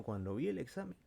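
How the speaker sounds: ordinary delivery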